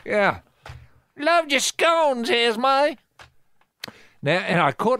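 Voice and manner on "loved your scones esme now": much higher and slow, put-on praise; high neighbour voice. Host playing neighbour praising Esme's scones, high and slow. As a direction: coo over her baking as a neighbour.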